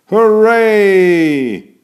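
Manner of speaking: high, loud joyful hooray; excited cheer. Acted. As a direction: cheer as the ball takes off. Children cheer on launch.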